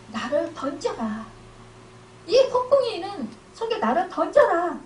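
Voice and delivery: normal talking voice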